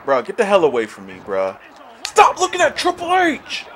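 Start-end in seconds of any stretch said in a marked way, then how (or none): 2.1-3.7 high, loud command; frustrated yell. Real, not acted. Gamer yelling at his wrongly aimed wrestler, frustrated yell. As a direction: yell it at the game, exasperated.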